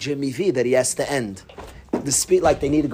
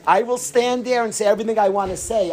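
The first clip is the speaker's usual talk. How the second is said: mock defiance; 4 semitones higher than usual. Dying coach to the man waving him down, defiant. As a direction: imitate someone refusing to budge, stretching it out.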